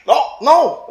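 usual speaking voice

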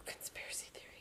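very soft, voiceless whisper; playful whisper. Pretend. Listener joking about the vanished TV host, playful whisper. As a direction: whisper it like a secret. Hushed joke about the host's disappearance.

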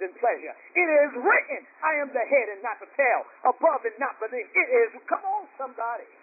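ringing high refrain, verses rolled out beneath it; fired-up preaching. Real, not acted. Preacher declaring what is written to the church, fired-up preaching. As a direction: declare scripture like a pulpit preacher.

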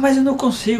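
mock the reluctance of a child; imitated protesting daughter.

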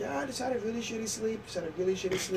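high-pitched, soft imitation of a feeble cover story; stoned excuse. Stoned friend excusing the shut eyes to visitors, feeble excuse. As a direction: make a weak excuse in a small high voice.

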